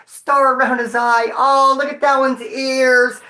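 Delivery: high, cooing, gushing over a cute puppy; cooing dog picker. Retold or imitated. Mocking cops choosing a police dog, cooing high. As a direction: coo like picking a cute pet. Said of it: "they don't just go".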